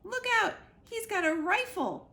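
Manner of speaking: put-on old cat shouting danger at the geese, loud and high; urgent warning. A narrator's reading. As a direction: yell a warning to the flock. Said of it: "Snooks hissed".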